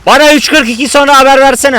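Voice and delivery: loud, high-pitched call. Real, not acted. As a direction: shout the request across the room. Calling out for a race reminder.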